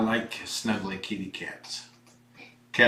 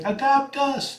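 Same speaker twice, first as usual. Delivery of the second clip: high, drawn-out mock kitten plea; pleading kitten; 3 dB louder than usual. Voicing foster kittens to would-be adopters, high and pleading. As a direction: plead like a kitten begging for a home. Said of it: "They're trying to use the".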